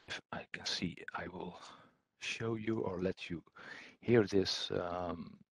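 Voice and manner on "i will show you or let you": ordinary talk, nothing marked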